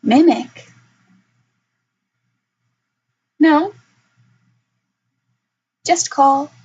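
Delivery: a small wary kobold answering curtly. Acted. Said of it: "the way that it's speaking normally".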